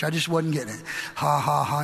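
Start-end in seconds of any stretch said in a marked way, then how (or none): 1.1-1.8 low, lifeless ha-ha; half-hearted, monotone laugh; forced laugh. Retold or imitated. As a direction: imitate trying and failing to laugh. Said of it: "It just wasn't working".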